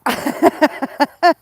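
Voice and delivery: loud, high rolling giggles; giggling fit. Real, not acted. Laughing fit.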